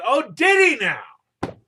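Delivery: slow, high, loud mock-incredulous king; the king's retort. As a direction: drag it out as the king, ominous and amused. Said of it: "And so the king says".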